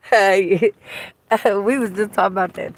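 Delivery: normal talking voice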